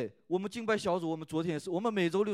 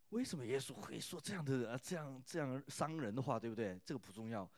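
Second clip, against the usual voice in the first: much softer, confiding aside; quiet aside; 4 semitones lower, 10 dB quieter than usual. Real, not acted. Pastor setting a point aside for the congregation, hushed. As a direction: drop the voice and brush it off. Aside dismissing the harsh words.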